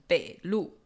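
北路 with 路 punched and falling; stress on second syllable. Shown as a demonstration. Teacher showing learners the correct country name, final stress on 北路. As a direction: hit the final 路 in 北路. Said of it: "把它发音发正确".

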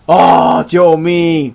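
loud, high wail for help; hammed-up plea for rescue; mock cry for help. Parent crying for help.

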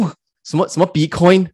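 jolted out of a doze, voice jumping up; a half-asleep man suddenly caught off guard, mildly replayed; startled awake. Retelling to his co-host how a massage question jolted him awake, mildly startled. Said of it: "我就突然惊醒".

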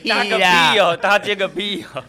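loud, high laughing retort. Real, not acted. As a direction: blurt it out through laughter. Laughing at co-host's bragging. (大笑着回嘴)

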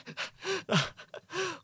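high breathy rising laugh; high giggle. Real, not acted. Laughing at own outburst. (尖声笑)

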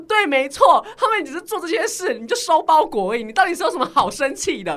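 loud, high indignant retelling; indignant rant. Retold or imitated. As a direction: vent at the clerks, loud and high. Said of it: "我说". (高声愤慨地抱怨柜台)

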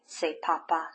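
plain reading voice

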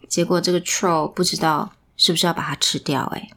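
plain reading voice

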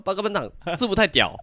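lisping "制服太屌", high and loud; 大舌头. Retold or imitated. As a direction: say "太屌" with a lisp. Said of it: "他讲话都大舌头这样子". (大舌头地说"制服太屌")

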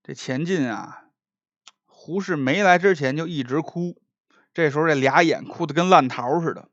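ordinary delivery

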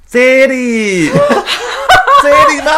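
high, loud imitated shout, breaking into a laugh; imitating the man's yell from below, laughing; mock yell. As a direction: yell it up at the window like the stalker. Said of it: "大喊说".

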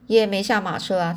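plain reading voice

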